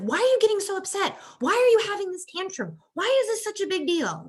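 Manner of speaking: mock the impatient parent, fed up; hammed-up frustrated parent at a tantrum; impatient exasperation. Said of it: "the lack of patience is like".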